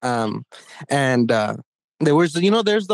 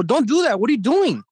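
higher-pitched mock telling-off; retold indignant protest; 7 semitones higher than usual. Boy telling off the chaperone who grabbed him, indignant. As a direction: act out being pissed off, pitched up. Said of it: "I was pissed at him".

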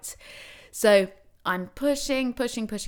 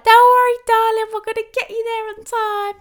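much higher, louder chirpy cabbie voice; cheery cabbie; 10 dB louder than usual. Retold or imitated. Cabbie reassuring the woman in labour, high and chirpy. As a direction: play the jolly London cabbie reassuring her.

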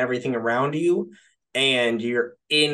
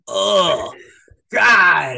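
exasperated groan; 5 semitones higher, 6 dB louder than usual. Retold or imitated. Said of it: "You're like".

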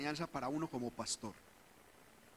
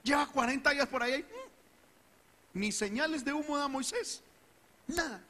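higher, quick gossipy complaint; gossiping crowd; 9 semitones higher than usual. Retold or imitated. People complaining to Aarón about Moisés, lightly voiced gossipy grumble. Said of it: "llegó el pueblo diciendo".

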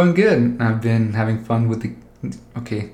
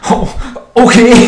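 much higher and louder sarcastic okay; mock surprise; 11 semitones higher, 9 dB louder than usual. Retelling the answer to the neighbor's threat, mock surprised. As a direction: squeal it like you couldn't care less. Mocking reply to police threat.

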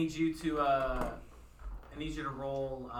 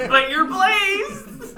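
laughing; 10 semitones higher, 12 dB louder, much slower than usual. Real, not acted. Laughing at stoned player.

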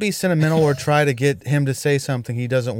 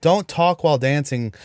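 ordinary talk, nothing marked

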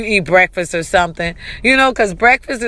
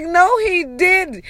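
excited squeal; 10 semitones higher, slower than usual. Retold or imitated. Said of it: "I was like".

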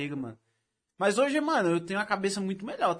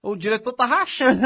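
laughing; 4 semitones higher, 8 dB louder than usual. Retold or imitated. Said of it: "Eu fico tipo".

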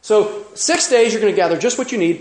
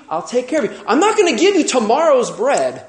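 earnest reassurance; 6 semitones higher than usual. Retold or imitated. Preacher voices God speaking.

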